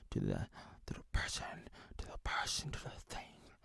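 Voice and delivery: voiceless whisper, trailing off. Acted. Keeping the sponsor's name secret, whispering. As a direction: drop to a conspiratorial whisper as if hiding a name.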